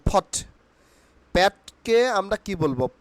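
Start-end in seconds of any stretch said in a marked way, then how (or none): 0.1-0.3 short ফট opening on an aspirated p; aspirated P in POT. Shown as a demonstration. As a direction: say ফট with a puffed ph at the start. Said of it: "পটকে আমরা কি বলবো?".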